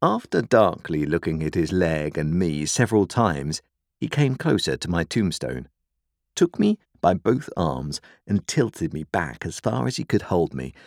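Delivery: ordinary delivery, nothing marked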